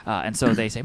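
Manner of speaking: ordinary talk, nothing marked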